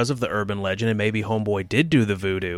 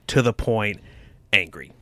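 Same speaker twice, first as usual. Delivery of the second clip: usual speaking voice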